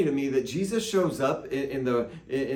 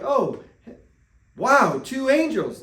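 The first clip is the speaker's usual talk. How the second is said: high, slow, wide-eyed gasp at the angels; hammed-up wonder; mock amazement; 10 semitones higher, 6 dB louder, slower than usual. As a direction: play up the awe. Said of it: "She's not even like".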